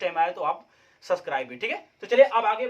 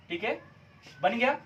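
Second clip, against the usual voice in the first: ordinary talk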